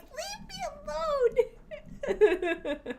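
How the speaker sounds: squeaky high whine breaking into a laugh; whiny protest. Retold or imitated. Retelling begging friends for vodka, high squeaky whine. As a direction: wail it like a sulking kid. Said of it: "I'm like".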